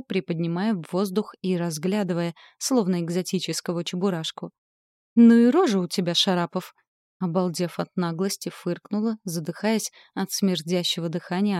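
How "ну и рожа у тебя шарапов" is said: mocking shout. A narrator's reading. Monster mocks the heroine.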